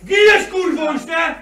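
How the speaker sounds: high, loud, aggressive shouting; real heated yelling; angry threat. Real, not acted. Threats in a real street quarrel.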